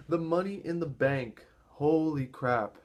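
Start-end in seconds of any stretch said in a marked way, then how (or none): none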